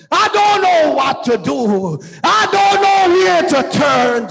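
ordinary talk, nothing marked

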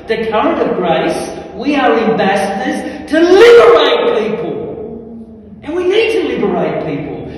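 loud, high, ringing charge to the congregation; fired-up preacher exhorting the crowd; full-force preaching. Real, not acted. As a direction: preach it loud and high, driving every phrase home.

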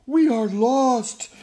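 slow, loud, high lament; despairing wail. Acted. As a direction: wail as if all is lost. Townspeople despair.

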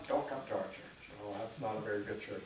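lightly voiced member talking a newcomer out of coming; mock gossiping church member. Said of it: "if somebody was gonna come".

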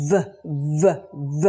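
"V" as a short voiced buzz, not the letter name; V letter sound. Shown as a demonstration. Teacher shows learners the sound of V, sounded out. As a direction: sound out "V" as separate "vuh" beats. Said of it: "เสียง V นะคะ".

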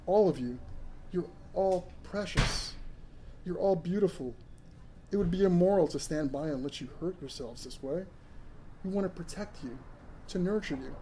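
compassionate. Acted. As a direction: soothe them gently. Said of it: "His every word oozed compassion".

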